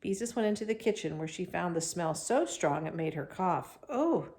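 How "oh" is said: calling out. A narrator's reading. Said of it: "she called".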